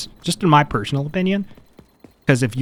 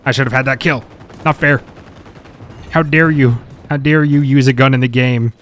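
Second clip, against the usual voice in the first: mock outrage; 7 dB louder than usual. Mock outrage at sniper death.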